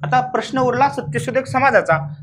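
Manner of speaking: ordinary talk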